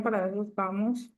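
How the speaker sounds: usual speaking voice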